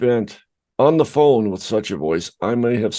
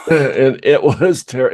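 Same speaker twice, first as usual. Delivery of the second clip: slow, louder, laughing as it starts; 4 semitones higher, 6 dB louder, slower than usual. Real, not acted. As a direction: break into a real laugh while talking. Laughing at his own bad demo.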